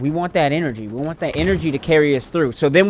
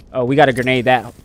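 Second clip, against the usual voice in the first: ordinary talk, nothing marked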